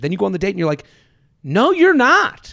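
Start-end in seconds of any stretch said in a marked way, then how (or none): 1.4-2.5 high, drawn-out contradiction; blunt disbelief. Acted. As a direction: call the bluff, stretching it high. Acted reply to a date's claim.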